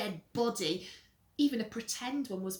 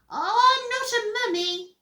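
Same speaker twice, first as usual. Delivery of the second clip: put-on high, loud child voice; whiny little brother; 13 semitones higher, 13 dB louder than usual. Peter catching Henry in his room, high whine. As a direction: pipe up in a high brat voice.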